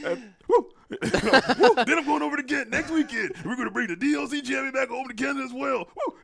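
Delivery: high, loud, fast hype promo; French Canadian wrestler impression. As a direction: shout it like a WWE promo, brother. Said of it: "that's the French Canadian accent".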